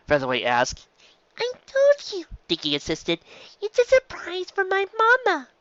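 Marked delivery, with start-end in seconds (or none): 1.4-2.2 put-on high, small child's voice; a young filly insisting, pitched way up; little filly voice. Child filly Dicky speaks. 3.6-5.5 put-on high, small child's voice; a young filly insisting, pitched way up; little filly voice. Dicky explains her surprise.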